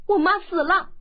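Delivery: put-on high, loud tearful child's voice; a boy telling of his mother's death, crying. A narrator's reading. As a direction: blurt it out sobbing. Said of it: "武修文哭道".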